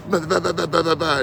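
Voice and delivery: high, loud mock babble; imitated sputtering. Angry traveler caught off guard by the fire, mock sputtering. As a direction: sputter a string of bahs, imitating him. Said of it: "He just, he just stopped. He's like".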